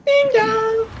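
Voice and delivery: very high, held playful ding-dong; mock doorbell chime. Caster echoing co-caster's ding dong, playful high chime.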